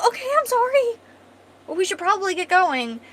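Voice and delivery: high, loud, rattled acted apology; flustered apology. Man yelled at by startled companion, apologizing in a fluster. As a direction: blurt it high, backing off.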